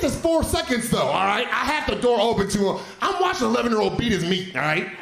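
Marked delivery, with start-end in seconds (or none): none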